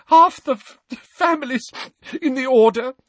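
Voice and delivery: very high, loud, stammering weeping; tearful outpouring. A narrator's reading. Molly confessing her fears for the family, weeping. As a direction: pour out every fear through sobs. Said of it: "tears spilling out of her eyes again".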